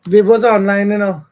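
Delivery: calling out loudly; telling him loudly across the room. Real, not acted.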